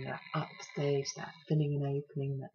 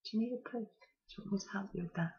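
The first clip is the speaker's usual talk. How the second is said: soft, higher, hushed offer; gentle whisper; 5 semitones higher, 6 dB quieter, much faster than usual. Retold or imitated. Student midwife offering help, softly whispered. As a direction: murmur it kindly into her ear. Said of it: "she just whispered in my ear".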